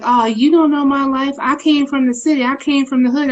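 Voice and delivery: mild imitation of kids insisting; imitated defiant kids. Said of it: "they'll be like".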